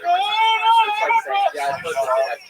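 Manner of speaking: loud, high, drawn-out fake cry; hammed-up drunken howl; mock wailing. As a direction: wail for the parents' attention. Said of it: "I was wailing in my room".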